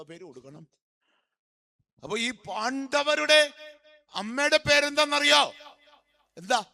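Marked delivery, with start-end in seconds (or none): 2.0-6.6 high, loud preacher's question; raised voice, calling for an answer; loud quizzing. Real, not acted. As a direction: throw the question out to the whole crowd. Preacher fires question at congregation.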